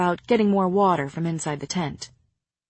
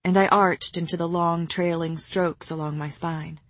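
usual speaking voice

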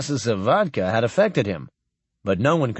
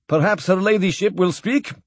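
put-on raised, formal old admiral with a foreign lilt; lightly voiced stately admiral toasting; old Russian admiral; 6 semitones higher than usual. Admiral addressing Captain Blaine at the farewell dinner, lightly voiced and stately.

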